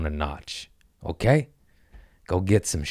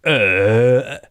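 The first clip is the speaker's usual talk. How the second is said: louder dazed mock "Uh"; stoned grunt; 6 semitones higher, 8 dB louder than usual. Mocking the guy who smokes too much, a dazed grunt. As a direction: play a burnt-out stoner grunt. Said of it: "the guy that smokes and goes".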